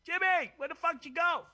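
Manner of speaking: loud shout across the room; shouting after a missing friend; calling out. Real, not acted. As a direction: yell for Jimmy who left. Calling out to absent cohost.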